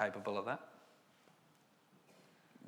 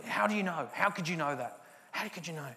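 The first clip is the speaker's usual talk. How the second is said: higher, pressing disbelief; frustrated colleague demands how he can know, mildly raised; 7 semitones higher than usual. Retold or imitated. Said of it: "He's getting frustrated at me".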